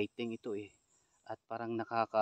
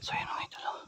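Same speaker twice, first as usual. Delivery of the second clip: whispering while hiding; 4 dB quieter than usual. Real, not acted. Hiding while filming a couple.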